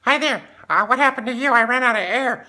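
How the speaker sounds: squeaky high, loud helium voices. Retold or imitated. Divers chatting inside the air dome, squeaky helium voice. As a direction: talk like you've sucked a balloon. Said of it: "sounds like you're talking helium".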